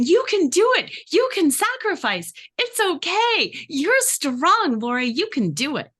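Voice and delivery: very high, loud peppy pep talk; self cheerleader. Retold or imitated. Speaker cheering herself on to sacrifice, high and bubbly. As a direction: gush encouragement at yourself. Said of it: "my default voice is the cheerleader".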